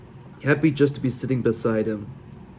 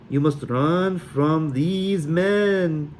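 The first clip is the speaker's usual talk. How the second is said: put-on very slow, high, terrible Sully accent; ominous mock Sully warning; drawn-out fake Sully fortune; bad Sully accent; 6 semitones higher, much slower than usual. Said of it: "My accent was even worse than Mal's".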